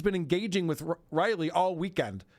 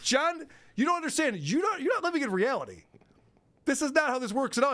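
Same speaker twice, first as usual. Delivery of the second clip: higher, exasperated scolding of John; higher pitched mock lecture, brisk at the end; exasperated judge; 9 semitones higher than usual. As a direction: talk down to him like a fed-up judge.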